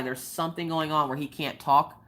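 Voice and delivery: usual speaking voice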